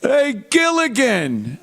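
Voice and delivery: a starstruck fan hailing him across the hall; fan calling out. Retold or imitated. Fan at a convention greets Gilligan, slow and high. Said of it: "He'd show up at these lame has-been conventions".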